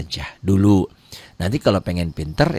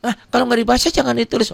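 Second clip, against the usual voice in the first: much higher, challenging student voice, fast at the end; critical student; 3 dB louder, faster than usual. Retold or imitated. Teacher mimics a critical student questioning the rule, high and challenging. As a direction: play the pushy questioning student. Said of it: "ada juga yang kritis".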